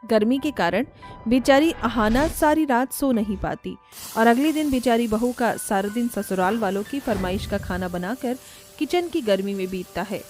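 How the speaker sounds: ordinary delivery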